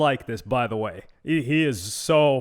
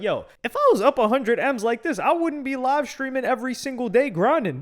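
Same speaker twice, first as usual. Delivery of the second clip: laughing disbelief; 7 semitones higher than usual. Retold or imitated. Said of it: "you're like".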